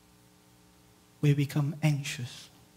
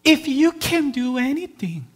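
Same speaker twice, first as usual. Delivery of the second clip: doubtful plea; 10 semitones higher, 8 dB louder than usual. Retold or imitated. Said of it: "what he said is".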